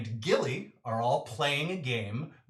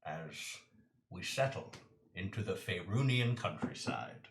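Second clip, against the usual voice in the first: softer and softer dramatic narration; dramatic scene narration; 9 semitones lower, 12 dB quieter than usual. Acted. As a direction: set the scene with growing hush.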